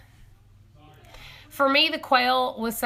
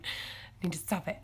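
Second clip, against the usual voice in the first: whispered to herself; 3 semitones lower, 10 dB quieter than usual. Real, not acted. Calming herself after squealing.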